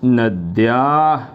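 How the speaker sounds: नद्या said slowly as nad-yaa, half द joined to या; teacher modelling the correct form; careful pronunciation. Shown as a demonstration. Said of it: "यहाँ बनेगा".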